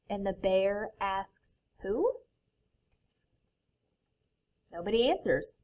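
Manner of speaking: lively picture book reading; reading to children. A narrator's reading. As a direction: read it like a bedtime story.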